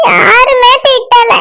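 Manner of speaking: slow, drawn-out sobby whine; crying. Acted. Crying friend denies being scolded, tearful. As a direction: stretch it out through tears. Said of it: "திட்டாததுக்கு இவ்வளவு அழுகையா".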